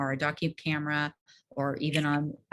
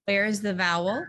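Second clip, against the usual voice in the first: "Where" lifted, louder prompting question; teacher prompt; 5 semitones higher, 5 dB louder than usual. Shown as a demonstration. Teacher prompting the class students, louder and higher. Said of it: "you become the students".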